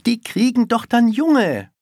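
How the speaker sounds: loud, high outburst; exasperated schoolboy. A narrator's reading. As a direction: blurt out the obvious. Boy blurts out the obvious.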